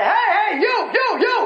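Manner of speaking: high shout across the street; calling out. Retold or imitated. Stranger on the street hailing the preacher with a shout. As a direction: call out hey you to get attention.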